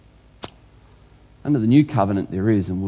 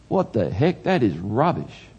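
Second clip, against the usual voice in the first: normal talking voice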